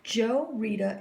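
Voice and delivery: usual speaking voice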